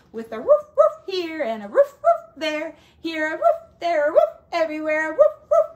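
loud woofs sung like a dog; barking woofs in song. Pretend. Teacher sings the dog part, barking woofs. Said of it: "he had a dog".

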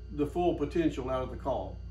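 ordinary talk

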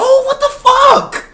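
very high, slow, cracking yell; exasperated scream. Real, not acted. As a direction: shriek it in disbelief. Exasperated outburst.

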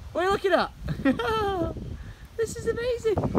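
high, excited gushing; giddy, high-pitched delight; thrilled. Real, not acted. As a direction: marvel out loud at every new sight. Marvelling at free-roaming animals.

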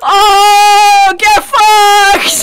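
long, loud triumphant yell; gloating shout. Real, not acted. Player gloating over a friend's death, triumphant shout. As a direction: stretch it out and crow.

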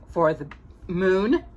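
ordinary delivery, nothing marked

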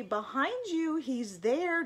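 put-on high, urgent child's warning; mild scream. A narrator's reading. Eddie warns Mom the bear is behind her, mildly screaming. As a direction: cry out the danger behind her. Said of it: "screamed Eddie".

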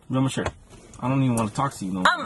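low, fast, dismissive mutter; low sulky sneer. Acted. Sulky retort in argument.

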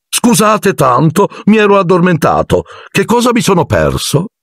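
very high, sing-song cheerful voice; radiant Lockhart. A narrator's reading. (raggiante, tutto giulivo)

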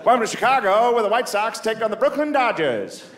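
put-on high old-timey radio announcer voice. Comic imitating an old radio announcer, pitched high. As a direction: call it like a vintage ballgame broadcaster. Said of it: "You can hear the announcers".

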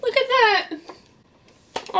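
real shock at the broken gear; dismayed exclamation. Real, not acted. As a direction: pitch leaps up as you show the damage. Showing broken slider on camera.

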